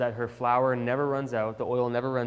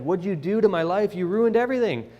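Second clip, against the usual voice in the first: higher-pitched, heated accusation; upset, raised-voice demand for answers; frustrated; 5 semitones higher than usual. Acted. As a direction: confront them as if grabbing their shirt. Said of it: "And so she's frustrated".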